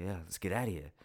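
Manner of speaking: soft, low laid-back cool guy; Ryan Gosling voice. Retold or imitated. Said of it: "He's like".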